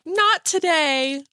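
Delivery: high, slow, drawn-out not today; a sing-song mock dismissal; playful refusal. Imagining a listener skipping the gun violence part, said with a high, slow playful refusal. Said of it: "So if you're like".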